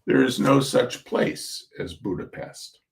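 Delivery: ordinary delivery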